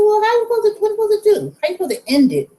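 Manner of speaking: high, bewildered asking; puzzled self-quote. Retold or imitated. To herself at the checkout, high and bewildered. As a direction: act lost at the register.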